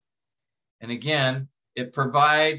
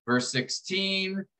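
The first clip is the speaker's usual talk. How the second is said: usual speaking voice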